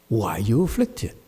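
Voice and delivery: ordinary delivery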